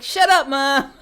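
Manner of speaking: put-on loud, high Boston-kid snap at his mother; imitated Boston son, yelling; mock Boston accent yell. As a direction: shout it like an annoyed Boston teen. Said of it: "in a Boston accent".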